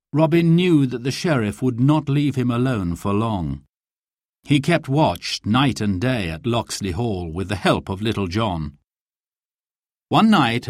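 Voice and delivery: usual speaking voice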